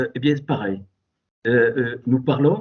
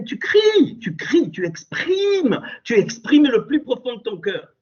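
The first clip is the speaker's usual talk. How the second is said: impassioned shout; 8 semitones higher than usual. Shown as a demonstration. Preacher telling the faithful to cry out their heart, a high impassioned shout.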